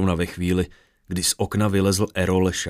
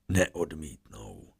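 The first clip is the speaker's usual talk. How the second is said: menacing brute; 4 semitones lower, 8 dB quieter, much slower than usual. Acted. Brute threatens, fighters collapse.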